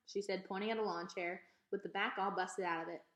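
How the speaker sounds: ordinary delivery, nothing marked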